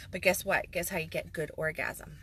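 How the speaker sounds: normal talking voice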